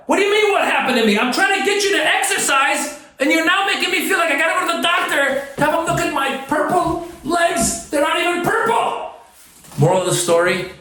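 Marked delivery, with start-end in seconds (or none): 0.1-9.1 much higher, fast, fed-up ranting; exasperated rant. Real, not acted. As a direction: vent at the trolls in frustration.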